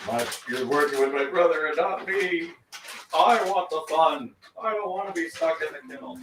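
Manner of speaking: lightly voiced whiny dog; voicing the other dog. Owner voicing Shishomaru's complaint about being kenneled, lightly mock whiny.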